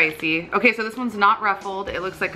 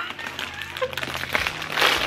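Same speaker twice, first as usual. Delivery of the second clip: high squealing laugh; 6 dB quieter than usual. Real, not acted. Reacting to chip smell.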